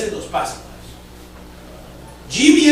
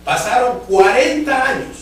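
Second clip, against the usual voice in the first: cuarenta stretched out, higher and louder; emphatic stress; 6 semitones higher, 5 dB louder, slower than usual. Acted. Preacher to congregation, drawing out cuarenta. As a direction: lean hard on cuarenta, slowly.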